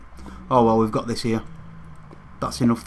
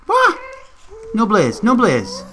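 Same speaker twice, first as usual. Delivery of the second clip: high, louder panicked protest; startled alarm; 10 semitones higher, 4 dB louder, slower than usual. Real, not acted. Gamer caught out by a blaze, alarmed. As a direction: yelp it, then plead in a higher voice.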